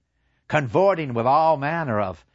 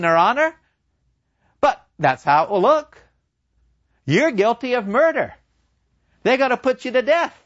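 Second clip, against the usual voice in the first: higher, louder, scornful devil; mocking devil; 7 semitones higher, 4 dB louder, faster than usual. Devil scoffing at Faust's plan to explain, mocking. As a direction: jeer at the trapped doctor.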